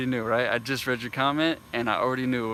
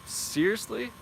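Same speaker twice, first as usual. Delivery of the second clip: much higher, disbelieving question; can't believe what he's hearing; high-pitched are you serious; incredulous; 10 semitones higher, slower than usual. Real, not acted. Incredulous at commenters' claim.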